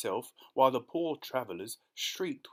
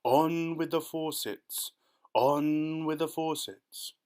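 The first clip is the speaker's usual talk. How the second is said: slow, louder far-off call; booming shout; 4 semitones higher, 5 dB louder, slower than usual. Acted. Cloud men shout from above, slow and booming. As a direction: boom down from the cloud. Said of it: "it shouted".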